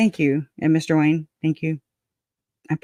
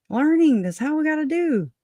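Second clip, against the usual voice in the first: emphatic high; 11 semitones higher than usual. Real, not acted. Preachy emphatic point.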